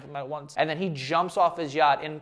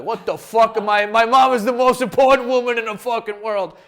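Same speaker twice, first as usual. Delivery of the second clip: loud, higher indignant mock Italian guy, lightly voiced; 4 semitones higher, 7 dB louder than usual. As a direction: play an offended mama's boy defending his mom, a touch hammed-up. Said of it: "some Italian guys that would read this and be like".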